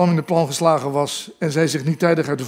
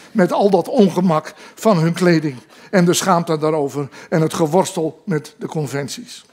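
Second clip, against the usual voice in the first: ordinary delivery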